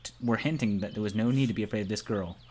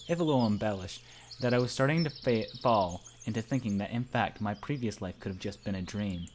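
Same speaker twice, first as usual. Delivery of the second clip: usual speaking voice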